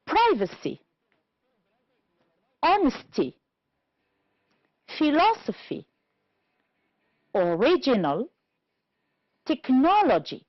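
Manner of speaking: "Privacy, honesty, philosophy, original, technology" stressed on PRI, HON, LOS, RIG, NOL; stress third from last. Shown as a demonstration. Teacher reading for careful listeners, "Privacy, honesty, philosophy, original, technology" each stressed third from last. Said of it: "two syllables before".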